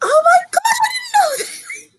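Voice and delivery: high squealed surprise with a laugh; amazed squeal. Real, not acted. Surprise on hearing lobster fact.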